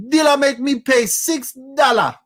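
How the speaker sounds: mock the annoying Jamaican player griping at the dealer; hammed-up Jamaican accent, whiny and shouted; imitating Jamaican lady. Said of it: "this is what she sounds like".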